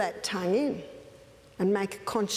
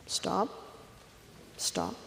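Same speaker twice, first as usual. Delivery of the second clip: soft hush; 3 semitones lower, 9 dB quieter than usual. Retold or imitated. Mother hushes crying baby.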